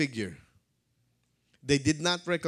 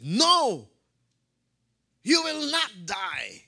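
high, loud, drawn-out refusal; fervent protest; 7 semitones higher, 5 dB louder, slower than usual. Acted. Preacher voices Peter protesting to Jesus, loud and fervent. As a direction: cry it out slowly with acted fervor. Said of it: "in his fervor".

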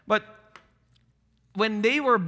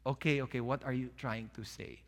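much softer and lower, gently asking; calm, backing down; 7 semitones lower, 10 dB quieter than usual. Acted. Family member calms the argument down, soft and gentle. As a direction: ease off and ask quietly, like ending a fight. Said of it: "Someone has to calm down and say".

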